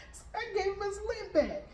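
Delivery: high-pitched, talking through laughter; laughing. Real, not acted. Mom telling how she gave in to her son, laughing. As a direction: laugh your way through the line.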